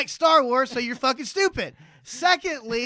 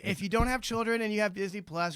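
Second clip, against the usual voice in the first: ordinary talk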